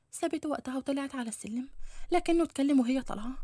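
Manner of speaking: plain reading voice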